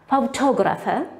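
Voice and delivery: "photographer" stressed on the second syllable, TOG; careful model of "photographer", second syllable prominent; word stress demonstration. Shown as a demonstration. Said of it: "We say one syllable very loudly".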